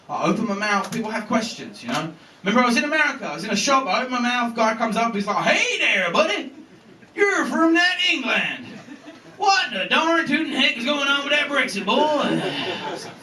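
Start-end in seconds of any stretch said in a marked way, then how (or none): 5.4-12.0 put-on American accent. American shopper accosting the British comedian, broad mocking American accent.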